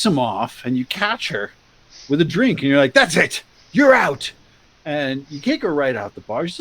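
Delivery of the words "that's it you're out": loud, punched ejection; bouncer's shout. Retold or imitated. Bouncer kicking an underage girl out, shouting. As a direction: throw them out loudly, voice climbing.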